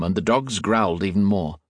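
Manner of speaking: ordinary delivery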